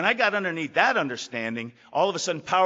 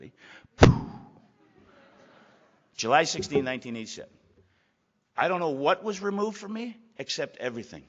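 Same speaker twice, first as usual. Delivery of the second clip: ordinary delivery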